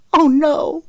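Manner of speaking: high, loud cry of dread; panicked cry. A narrator's reading. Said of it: "she cried".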